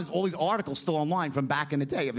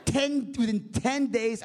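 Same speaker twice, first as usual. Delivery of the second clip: usual speaking voice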